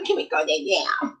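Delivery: put-on high, whiny little-kid voice begging to go to bed; whiny tired child. Child whining to Mama about bedtime, high and whiny. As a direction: pitch up and whine like a sleepy toddler.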